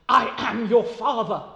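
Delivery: loud, portentous reveal; a lawyer declaring his paternity; weighty revelation. Acted. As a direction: boom the secret out. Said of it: "in italics".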